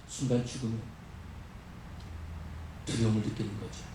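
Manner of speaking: low, soft, grave narration; hushed dread. A narrator's reading. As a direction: drop right down for the fear of death. Storm climax drops to dread. (죽음의 두려움을 낮고 조용하게)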